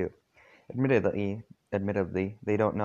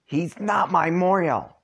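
high, loud, slow indignant yell; two kids yelling in unison, outraged; shouted denial; 10 semitones higher, 5 dB louder, slower than usual. Acted. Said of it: "both Solix and, uh, Karkat at the same time".

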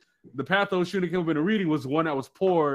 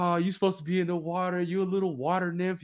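high, lightly mocking group taunt; mock teasing; 4 semitones higher, 3 dB quieter than usual. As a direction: imitate friends poking fun. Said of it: "they would make fun of me".

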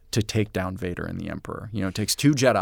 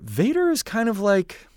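ordinary talk, nothing marked

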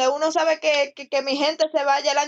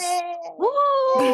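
a high, bright little cheer; cheerful yay; 10 semitones higher than usual. Real, not acted. Friend cheering the group's progress, high excited yay. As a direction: squeal it out for the team.

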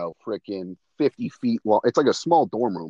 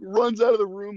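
ordinary delivery

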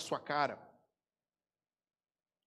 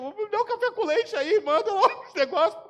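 much higher, louder put-on cheerful voice; mock cheerful order; 13 semitones higher, 9 dB louder than usual. Pastor acting himself ordering coffee, high and loud. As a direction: play it up, overly cheerful at the counter.

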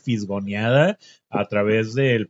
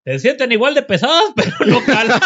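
laughing mock pitch; 9 semitones higher, 6 dB louder than usual. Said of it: "Le puso las bolsas y dijo".

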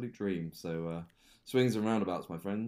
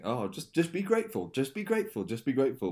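mocking mantra; 6 semitones higher, 5 dB louder than usual.

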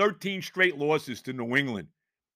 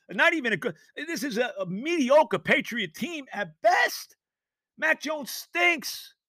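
higher, drawn-out slow yell of disgust; exasperated shout; 11 semitones higher, slower than usual. Real, not acted. Angry fan host ranting that the Patriots are mediocre, slow exasperated shout. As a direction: drag out the insult, fed up.

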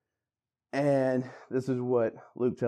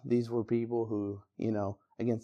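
ordinary delivery

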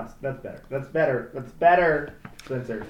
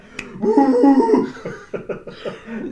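high, loud imitation howl; imitated doggy bark-howl; gut-busting dog howl; 10 semitones higher, 7 dB louder than usual. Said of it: "full on busting a gut".

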